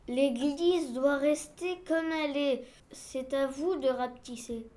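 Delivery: very slow, measured character voice; solemn explanation, words stretched; ponderous official. Acted. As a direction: spell it out slowly and weightily. Reads the objector's part.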